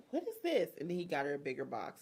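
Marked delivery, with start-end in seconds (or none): 0.1-0.7 a high, puzzled mock; mock baffled girlfriend. Girlfriend puzzled by the gift, mock high. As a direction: raise it up in disbelief. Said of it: "she was like".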